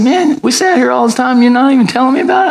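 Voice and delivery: high, fast mock disbelief; a hammed-up "you didn't tell me?"; incredulous surprise. Father to son at dinner, teasing disbelief.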